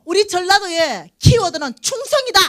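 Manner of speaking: high-pitched, emphatic proclamation; proudly proclaimed, pitched well up; assertive declaration. Shown as a demonstration. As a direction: declare it like a rallying slogan. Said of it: "이렇게 나가세요".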